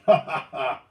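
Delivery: loud, high performed ha-ha-ha; mocking laugh. A narrator's reading. Bellegarde laughs at the losers, mocking. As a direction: laugh openly at their expense. Crewman jeers at tired paddlers.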